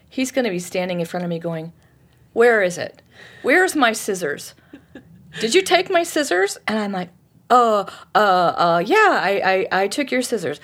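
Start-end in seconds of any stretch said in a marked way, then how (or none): 2.3-6.6 mock-indignant demand, pitch climbing into the question; accusing questions, each one pushed higher; confronting. Acts out husband confronting her. 7.5-10.6 stammering mock guilt; halting, sheepish owning-up; flustered confession. Acts her flustered guilty reply.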